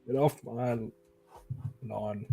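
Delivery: lightly voiced grumpy old man; a hint of a cranky old man, fading off. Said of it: "said in an old man voice".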